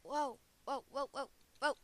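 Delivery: loud startled whoas; surprised whoa. Real, not acted. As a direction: react to a shock, loudly. Sudden surprise in the game.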